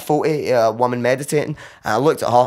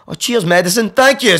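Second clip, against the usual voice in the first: relieved laughing thanks; 9 semitones higher, 5 dB louder than usual. Retold or imitated.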